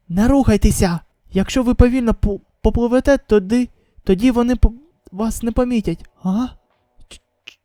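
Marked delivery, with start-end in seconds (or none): none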